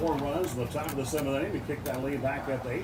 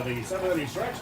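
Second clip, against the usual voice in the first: usual speaking voice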